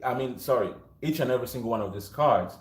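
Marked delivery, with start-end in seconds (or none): none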